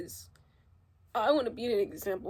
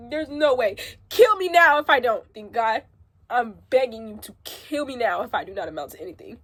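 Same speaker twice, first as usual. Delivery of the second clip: desperate outcry; 9 dB louder than usual. Real, not acted. To her viewers, crying out a loud desperate vow. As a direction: shout it like your life depends on it. Vowing through tears.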